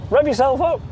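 high, loud "Rev yourself up"; hyped-up. Shown as a demonstration. Trainer mocking the excited tone, loud and high. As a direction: sound like you're winding the dog up. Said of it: "It sounds like".

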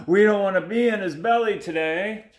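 high, anxious little fish; hammed-up mackerel worry; mock fearful moan. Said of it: "moaned the mackerel".

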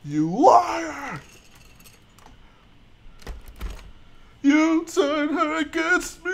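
high, strained cry of betrayal; hammed-up high-pitched accusing wail; anguished accusation. Streamer mimics a film confrontation, anguished accusation.

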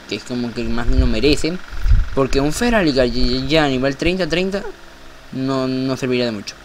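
ordinary delivery

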